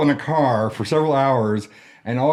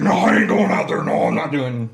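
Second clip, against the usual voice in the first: stubborn refusal, starting higher and louder; Mike; 6 semitones higher, 3 dB louder than usual. Retold or imitated. Friend refusing to go out for the bouquet, emphatic impression. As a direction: imitate a friend digging his heels in. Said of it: "that was a pretty spot on Mike impression".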